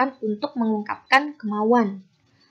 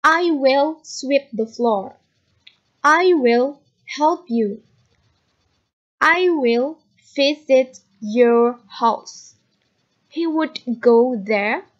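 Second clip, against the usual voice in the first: very slow, over-clear English sentences; very slow model reading to repeat; 2 semitones higher, 4 dB louder, much slower than usual. Shown as a demonstration. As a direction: stretch every word so students can copy it. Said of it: "listen to me carefully and repeat after me". (ucapkan sangat perlahan agar ditirukan siswa)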